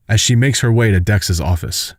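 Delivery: ordinary delivery, nothing marked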